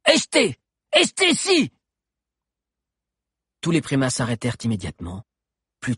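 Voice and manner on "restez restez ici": very high, screeched commands; piercing creature chief's yell; shouted order. A narrator's reading. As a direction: shriek at your fleeing troops. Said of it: "ordonna Cro-Bleu".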